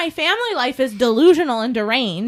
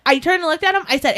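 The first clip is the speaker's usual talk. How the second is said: usual speaking voice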